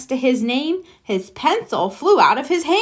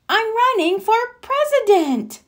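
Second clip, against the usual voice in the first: put-on high, eager kid frog; proud Froggy; 12 semitones higher than usual. Froggy tells his mother his plan, high and proud. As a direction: announce it like big news.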